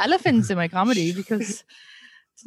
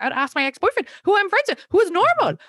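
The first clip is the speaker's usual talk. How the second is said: mock indignant quote; 8 semitones higher than usual. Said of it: "I was like".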